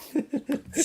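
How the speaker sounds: laughs up high. Real, not acted. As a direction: burst out laughing. Laughing at the bag scene.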